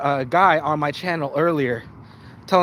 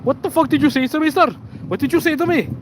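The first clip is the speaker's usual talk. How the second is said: retold angry yell; 11 semitones higher than usual. Scammer yelling at the caller, imitated high angry shout. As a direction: bark it back like the furious scammer.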